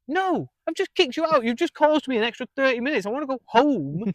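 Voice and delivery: high, loud exasperated refusal; fed-up retort. Retold or imitated. Bartender telling the customer he wants to go home, exasperated. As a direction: snap back like you've had enough. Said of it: "And he's like".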